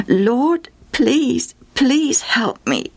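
pleading. Acted. Mother kneeling before Jesus, pleading. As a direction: beg at his feet. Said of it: "she pleaded".

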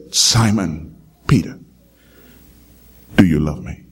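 low, solemn Jesus asking Peter; Jesus gently asking Peter if he loves him, low and solemn. Acted.

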